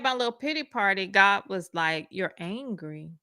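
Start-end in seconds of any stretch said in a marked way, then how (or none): 2.1-3.1 soft, slow and quiet; lightly voiced calm God. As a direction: speak as a patient God. God answers her complaint.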